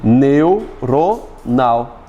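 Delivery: "Neuronal" very slow, neu-ro-nal; split into syllables. Shown as a demonstration. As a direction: leave gaps inside "Neuronal", neu, ro, nal. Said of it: "não está separada corretamente".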